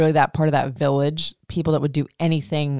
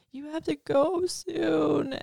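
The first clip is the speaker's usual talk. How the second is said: put-on sad little boy; 11 semitones higher, 5 dB quieter, much slower than usual. He starts crying.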